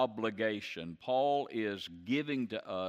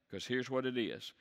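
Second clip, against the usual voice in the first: ordinary talk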